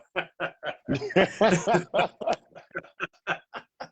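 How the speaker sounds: long real laugh. Real, not acted. Host amused by Dion's comment about Nick, laughing. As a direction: burst into drawn-out laughter.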